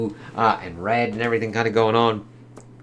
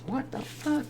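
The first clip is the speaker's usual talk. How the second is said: shocked wizard; 13 semitones higher, 10 dB quieter, slower than usual. Retold or imitated. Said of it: "he's like".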